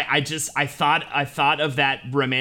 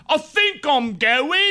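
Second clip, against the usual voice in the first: put-on much higher, slow British accent; 12 semitones higher, 3 dB louder, much slower than usual. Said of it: "next thing I'm gonna say is in a British accent".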